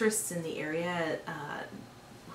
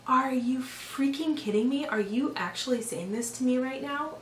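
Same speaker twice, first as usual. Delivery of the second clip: higher, incredulous retort; indignant outrage; 7 semitones higher than usual. Retold or imitated. Retelling her reply to the acupuncturist's remark, in incredulous outrage. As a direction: throw the disbelief back at her.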